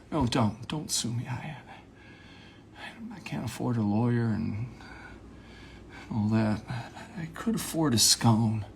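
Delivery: hushed, halting, grave confession; dead serious, with long heavy pauses; mock big talk. Confiding about a scone, hushed and solemn. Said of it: "have small talk as if it's big talk".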